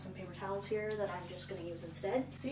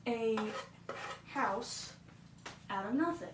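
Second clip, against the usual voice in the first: normal talking voice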